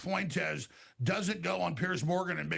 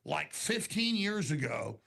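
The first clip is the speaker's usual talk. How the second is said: slow, deliberate, spelled-out delivery; pointedly slow, word by word; much slower than usual. Real, not acted. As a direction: lay it out slowly for someone not listening. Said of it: "I'm gonna say this very slowly".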